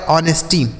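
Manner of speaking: "ऑनेस्टी" with no H, opening on O; correct silent H. Shown as a demonstration. Teacher models the right pronunciation for pupils, H silent in "ऑनेस्टी". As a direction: begin "ऑनेस्टी" straight on the vowel. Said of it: "देख लीजिए सही उच्चारण".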